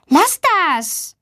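high-pitched telling-off; indignant "stop it" tone; scolding. A narrator's reading. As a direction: scold a teasing classmate sharply. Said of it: "Luisa schimpft".

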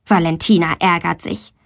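ordinary delivery, nothing marked

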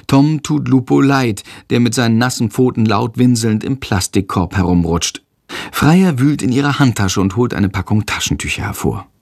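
plain reading voice

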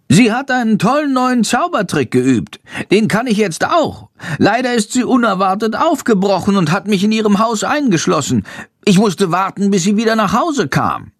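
high, eager little bat voice; a chirpy small creature telling news; little bat Shandor. A narrator's reading. Shandor the bat reports to Jendrik, high and eager.

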